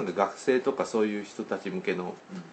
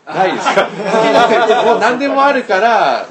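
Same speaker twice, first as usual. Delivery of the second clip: laughing; 10 semitones higher, 12 dB louder than usual. Real, not acted. Guest answers "none" bluntly.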